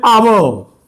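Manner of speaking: आवो, with a v-glide into a final o; "hour" as आवो. Shown as a demonstration. Teacher shows students how people say "hour", as आवो. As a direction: say "hour" as two syllables, आवो. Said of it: "लोग बोलते हैं".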